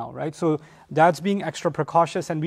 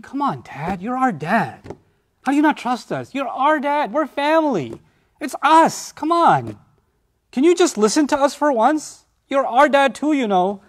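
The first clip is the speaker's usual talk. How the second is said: high, whiny pleading to Dad; pleading sons; 7 semitones higher than usual. Retold or imitated. Brothers pressing their father to trust them, pleading high. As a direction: beg like hurt sons who feel distrusted.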